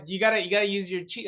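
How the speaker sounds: normal talking voice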